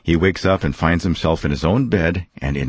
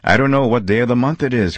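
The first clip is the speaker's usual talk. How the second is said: higher, fast excited cry; cried out; 6 semitones higher, 3 dB louder, faster than usual. Acted. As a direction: blurt it out in happy confusion. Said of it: "cried Scrooge".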